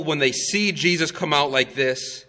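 usual speaking voice

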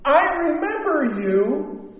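a hammed-up stranger saying he remembers her; imitating the man. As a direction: act out the stranger recognizing her. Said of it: "he goes".

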